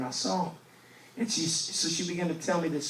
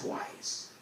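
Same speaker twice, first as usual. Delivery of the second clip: whispered emphasis; 10 dB quieter than usual. Acted. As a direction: breathe the word twice as a whisper. Punchline after "not once.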